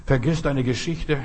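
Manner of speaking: ordinary delivery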